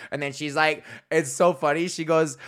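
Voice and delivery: ordinary delivery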